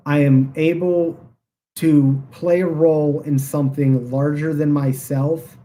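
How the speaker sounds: ordinary talk, nothing marked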